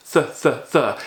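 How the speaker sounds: tongue between teeth, breathe "th"; TH sound demo. Shown as a demonstration. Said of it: "that is a T H sound".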